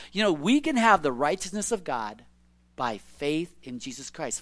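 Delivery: ordinary delivery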